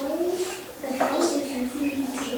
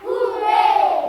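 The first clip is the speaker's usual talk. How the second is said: high, loud hooray; whole-class yell; shouted cheer; 8 semitones higher, 7 dB louder than usual. Real, not acted. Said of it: "shout hooray".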